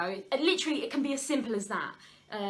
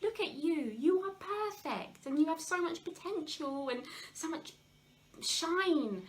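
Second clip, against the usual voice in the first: comforting parent; 8 semitones higher, slower than usual. Retold or imitated. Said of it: "you would be like".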